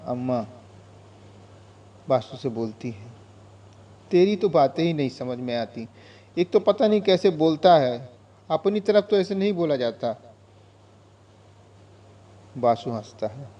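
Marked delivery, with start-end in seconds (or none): 4.1-10.2 put-on higher, louder scolding as an old mother; bemused mother chiding his odd Hindi; old mother's voice. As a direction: fuss at him like a puzzled amma. Amma scolding Basu's speech.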